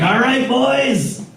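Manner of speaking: mock eager pirate recruit; eager new pirate. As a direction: pitch it up, a bright eager greenhorn asking about the plan. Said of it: "he gets all excited to be a pirate".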